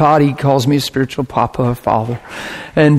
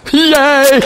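gleeful cheer; 9 semitones higher than usual. Real, not acted. Preacher celebrating his clear point, gleeful yay.

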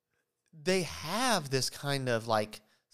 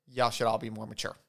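normal talking voice

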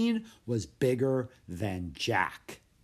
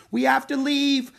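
loud, high desperate cry; panicked cry; 9 dB louder than usual. A narrator's reading. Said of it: "cried Annie".

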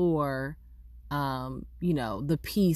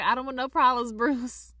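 higher, louder corrected take; raised-voice retake; 8 semitones higher, 4 dB louder than usual. Retold or imitated. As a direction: redo the line pitched well up and louder. Redoes the line with fake name.

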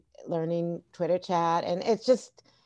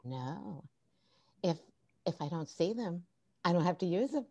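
very soft imitation of a reluctant teacher; hushed, hesitant mock admission; quiet refusal; 10 dB quieter than usual. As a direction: drop to a small, halting voice. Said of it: "And she goes".

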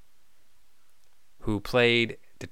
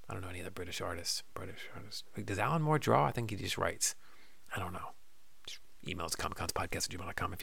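trailing whisper; 5 semitones lower, 15 dB quieter, faster than usual. Real, not acted.

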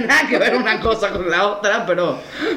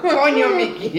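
[name] impression; 9 semitones higher, slower than usual. Quoting [name] series line.

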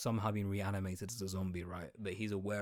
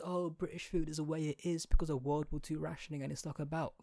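higher put-on parroting voice; mimicking the excuse-makers; 5 semitones higher than usual. Quoting everyone's excuse for British food, lightly mocking higher voice. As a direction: recite the tired excuse mockingly, pitched up. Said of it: "everyone always says".

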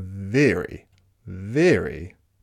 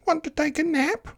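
high, quick mewing voice; cat voice; faster than usual. Acted. Cat inviting the spider to nap, high mew. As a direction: squeak it like a little cat. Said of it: "cried the cat".

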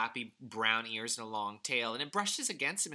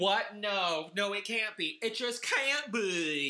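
mock disbelief; 6 semitones higher, slower than usual. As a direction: play the gasp at the plot twist big.

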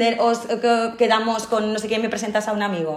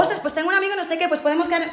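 higher, breezy matchmaking voice; mock eager matchmaker; 8 semitones higher, 3 dB quieter, faster than usual. Podcast host mimicking dinner guests who push a date on her. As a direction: play the meddling dinner guest. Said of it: "ya están pensando".

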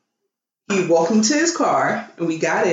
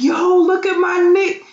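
put-on high, loud drunken exclamation; drunk shout; 11 semitones higher, 4 dB louder than usual. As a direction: call out like a tipsy fan.

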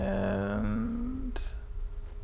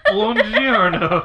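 mock Italian; 4 semitones higher, 7 dB louder, much slower than usual. Punning Italian greeting, drawn out.

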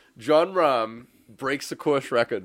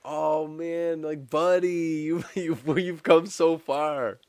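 high, louder gushing to a buddy; retold heartfelt cheer, pitched up; moved fan; 7 semitones higher, 5 dB louder, slower than usual. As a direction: gush like a touched fan, drawing out the end. Fan retelling his reaction to win.

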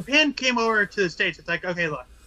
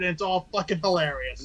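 ordinary talk, nothing marked

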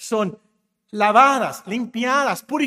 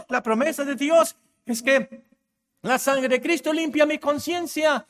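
usual speaking voice

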